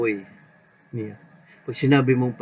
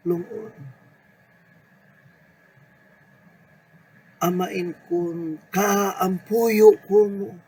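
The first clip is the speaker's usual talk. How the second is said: fervent lament; 3 semitones higher than usual. Acted. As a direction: pour out the burden like a desperate prayer. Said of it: "panawagan na marubdob ang damdamin". (marubdob na panaghoy sa Panginoon)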